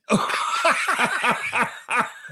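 high, breathy laugh at his own story; burst of laughter. Real, not acted.

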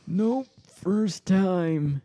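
put-on high, very slow old man. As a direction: drag it out like a frail old man. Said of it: "So this is the old man voice".

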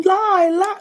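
very high heckler's shriek; mock screaming. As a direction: imitate a screeching heckle without full volume.

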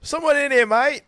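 high mock-Aussie call from inside; Australian accent. Golfer answering through the toilet stall door, mock Australian accent. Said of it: "an Australian accent".

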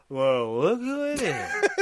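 lightly acted drunk greeting, drawn out. Drunk man greets visitors from top of the stairs, tipsy. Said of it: "he's drunk as fuck".

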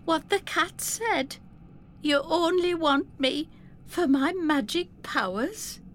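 put-on high, hurt girl's question; young Effie. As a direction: ask her father, shaken.

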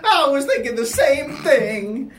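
put-on delighted old husband, high and loud; Barry Ovaltine. Husband agreeing with his wife, high, loud doting voice. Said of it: "I'm Barry Ovaltine".

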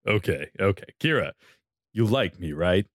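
low, calm commanding male voice; steady and in control, low; Arthur voice. Acted. Arthur voice again.

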